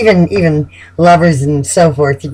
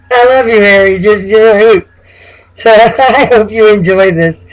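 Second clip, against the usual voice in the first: fond laughing; 4 semitones higher, 4 dB louder than usual. Real, not acted. Affectionate address to Aries.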